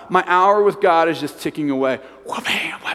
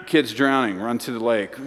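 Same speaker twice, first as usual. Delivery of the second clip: ordinary talk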